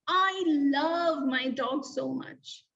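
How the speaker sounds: slow, emphatic exclamation; exclaimed example. Shown as a demonstration. Teacher reading an exclamation example, emphatic. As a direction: read it with strong feeling. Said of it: "We end with an exclamation mark".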